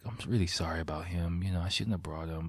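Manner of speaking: imitating a hushed apology; soft apology. Retold apology at a goodbye hug.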